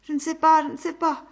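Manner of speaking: high, loud choked repetition; strangled voice of a man in agony. Acted. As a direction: gasp it out in pain. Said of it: "d'une voix suffoquée".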